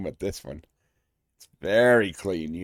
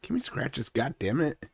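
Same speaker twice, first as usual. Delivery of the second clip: higher, slowed dog voice; voicing the dog; 7 semitones higher, 7 dB louder than usual. Host voicing his dog begging for scratches. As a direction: beg like the puppy. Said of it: "He's like".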